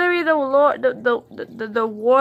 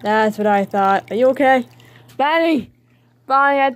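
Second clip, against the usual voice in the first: ordinary talk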